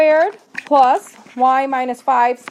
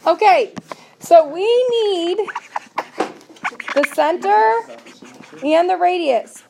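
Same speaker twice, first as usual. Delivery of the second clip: raised teacher voice; 6 semitones higher, 4 dB louder, slower than usual. Real, not acted. Teacher regains class attention.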